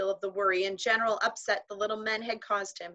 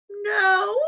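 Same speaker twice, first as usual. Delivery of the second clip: a high, louder "No" wobbling with held-in laughter; trembly voice; 12 semitones higher, 9 dB louder than usual. A narrator's reading. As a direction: say it shaking as a laugh fights to get out. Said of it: "said Omri in a trembly voice".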